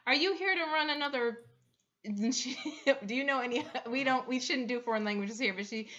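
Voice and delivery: ordinary talk, nothing marked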